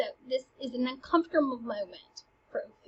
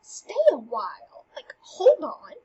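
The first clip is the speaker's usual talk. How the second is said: loud, higher and sassy, brushing the queen off; sassy Polonius; 4 semitones higher, 7 dB louder than usual. Acted. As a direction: talk back to the queen with cheek. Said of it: "Polonius gets really sassy".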